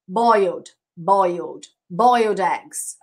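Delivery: "boiled" said slowly three times, falling; slow repetition drill. Shown as a demonstration.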